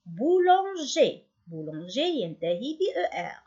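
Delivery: usual speaking voice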